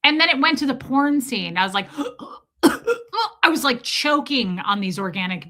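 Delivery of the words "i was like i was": shocked gasp. Retold or imitated. Shocked at porn scene on plane.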